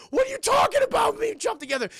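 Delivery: very high, loud mock alarm; alarmed yell. Jumper to crazed cop who wants to jump, high alarmed yell. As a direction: shriek it in disbelief.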